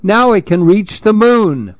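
high, cheering hooray; joyful call. Acted. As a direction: call up from the bottom of the well in delight. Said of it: "called to the others".

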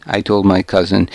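ordinary delivery

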